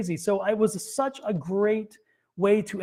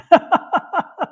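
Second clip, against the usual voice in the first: laugh; 12 semitones higher, 8 dB louder than usual. Real, not acted. Laughs at long reading.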